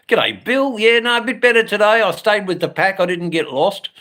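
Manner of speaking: bright and high, quick on the first lines; high, cheery mock chat voice; chirpy chat reply. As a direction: play the cheerful regular answering a viewer. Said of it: "we can talk about".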